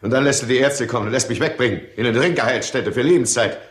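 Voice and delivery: fast, climbing into a high furious accusation; building angry outburst. Acted. Drunk husband accusing his wife, fast angry outburst rising to a cry.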